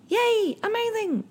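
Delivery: very high, squeaky little cheer; excited squeal. Acted. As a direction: squeal it with delight. Cheering own masterclass announcement.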